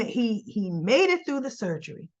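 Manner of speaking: normal talking voice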